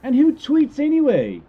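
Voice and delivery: high, loud scoff; exasperated shout. Real, not acted. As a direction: blurt it out exasperated. Sudden outburst on tweeting.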